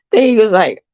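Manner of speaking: usual speaking voice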